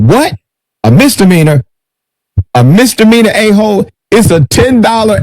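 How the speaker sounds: high-pitched, incredulous quote; retold indignation, high but not shouted; mock indignant retort. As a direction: act out an outraged comeback, pitched well up. Acted retort in arrest argument.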